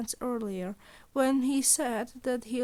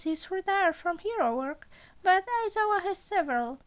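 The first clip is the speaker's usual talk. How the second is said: higher, playful voice for the scheming principal; sly, amused old mentor toying with his student; Nezu voice; 7 semitones higher, 5 dB louder than usual. Acted. Principal Nezu teasing Izuku about the job, higher sly voice.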